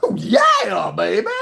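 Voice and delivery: high, shouted rock-star yell. Retold or imitated. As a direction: scream it like an eighties rock singer. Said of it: "Def Leppard voice".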